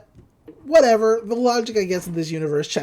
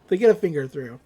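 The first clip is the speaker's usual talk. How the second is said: ordinary talk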